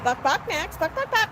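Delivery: high chicken clucks, rising at the end; playful clucking. Pretend. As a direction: cluck to coax a bird into answering. Clucking to get Max to answer.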